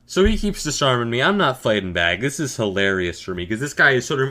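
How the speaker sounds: normal talking voice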